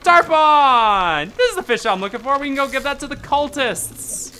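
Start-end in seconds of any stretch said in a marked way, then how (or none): 0.0-2.4 excited. Real, not acted. Spots the fish he wanted.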